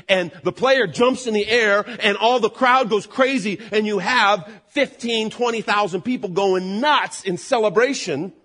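loud, slow, rising excitement; excited build. Acted. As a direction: build it up like a game-winning shot. Describes crowd going crazy.

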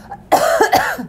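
rough smoker's cough; dirty cough. Real, not acted. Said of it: "there's that dirty cough".

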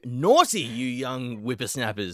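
put-on slow, high cranky old man; mock old man scolding. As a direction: tut at them like a grandpa.

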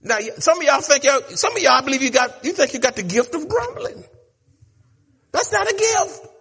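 preacher lifting the crowd; rising preacher call. Acted. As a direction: pitch climbing high and loud to a peak. Preacher building up.

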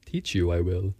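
low, inverted Yoda speech. Retold or imitated. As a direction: do a bit of Yoda.

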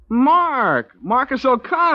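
loud, high shout of recognition; delighted greeting. Acted. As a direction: call out to an old friend you haven't seen in years. Old army pal spots friend.

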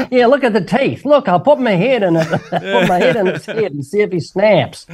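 real laughter mid-sentence; laughing. Real, not acted. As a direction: talk while laughing at the joke. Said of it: "laughing through a crocodile joke".

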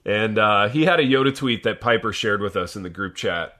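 ordinary delivery

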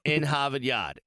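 a light mock Boston accent, half-laughing. Boasting he's from Boston, in a playful Boston accent. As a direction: drop the r's Boston style, tongue in cheek. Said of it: "I'm from Boston".

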